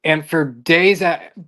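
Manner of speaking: ordinary talk, nothing marked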